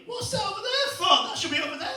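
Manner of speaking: high mock shrieking wife voice; mock shouting wife. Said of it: "She's shouting at me".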